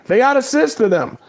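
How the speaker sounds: ordinary delivery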